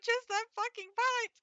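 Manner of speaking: high, strained, on the edge of cracking up; laughing. Real, not acted. Streamer apologizing about that pipe, laughing. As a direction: burst out apologizing through laughter. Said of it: "I'm laughing while Klonoa's crying".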